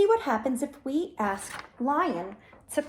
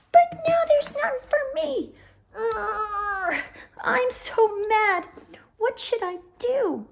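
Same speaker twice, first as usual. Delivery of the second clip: mad turtle; 7 semitones higher, 4 dB louder than usual. Acted. Turtle gets angry.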